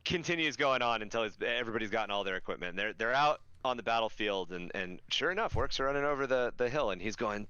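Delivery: ordinary delivery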